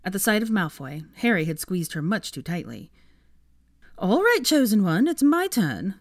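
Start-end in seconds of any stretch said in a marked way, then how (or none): 4.0-6.0 high, loud, impatient snap; pitched-up, demanding interruption; snappish. A narrator's reading. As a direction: snap at him to give way. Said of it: "snapped Ginny".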